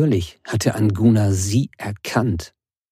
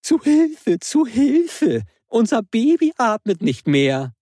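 shrill mocking falsetto, far higher; 12 semitones higher than usual. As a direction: mimic a panicked woman in falsetto. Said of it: "ahmte mit hoher Stimme nach".

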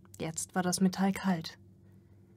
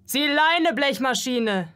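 much higher and louder, a rough snarl; snapping; 6 semitones higher, 6 dB louder, slower than usual. A narrator's reading. Worker barking at the metal servant to leave, loud snarl. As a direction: snap at him to get lost. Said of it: "schnauzte ein anderer".

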